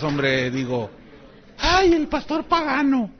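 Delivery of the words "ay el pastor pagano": exaggerated, comic gasp of disapproval; mock scandalized. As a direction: play a shocked accuser for laughs. Mocking critics' scandalized reaction.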